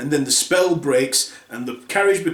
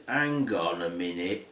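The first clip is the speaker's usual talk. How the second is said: low, soft suspicious voice; low and quiet, a hint of suspicion; suspicious stepmother; 10 semitones lower, 8 dB quieter than usual. Stepmother getting suspicious of Cinderella, low and soft.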